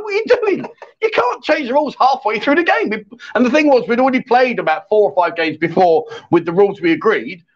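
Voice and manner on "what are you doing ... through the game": high-pitched mock outrage; retold indignant protest. Player objecting to rules changed mid-game, mock indignant. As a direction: protest in disbelief, pitch jumping right up.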